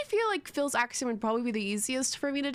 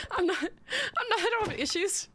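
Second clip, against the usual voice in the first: high voice breaking into giggles; real laughter squeezing the words upward; laughing denial; 10 semitones higher than usual. Real, not acted. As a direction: protest through helpless laughter. Laughs while denying having issues.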